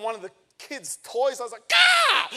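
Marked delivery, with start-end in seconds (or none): 1.7-2.3 sudden high, loud yelp of pain; retold yelp of pain from a toy underfoot. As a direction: cry out as if stepping on a toy. Stepped on kid's toy.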